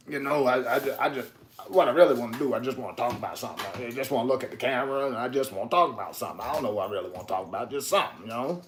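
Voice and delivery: fast, soft Southern mumble; hushed, running-on old Southern man impression. Shown as a demonstration. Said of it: "my old Southern man could turn into".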